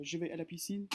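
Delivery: je vais à la piscine said smoothly in French; French sentence. Shown as a demonstration. Teacher giving an example sentence, in French. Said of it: "means I go to the".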